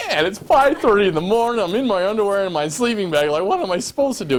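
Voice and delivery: high, loud, laughing protest; laughing exasperation. Retold or imitated. Storyteller answering the old lady's challenge, laughing high and loud.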